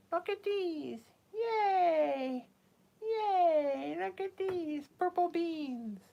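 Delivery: high, delighted cheer; excited cheer. Real, not acted. Showing off purple beans, excited cheer. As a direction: cheer like it's wonderful.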